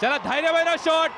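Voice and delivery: excited cricket commentary. Real, not acted. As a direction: shout with delight at the shot. Six live. (ఉత్సాహంగా అరుపు)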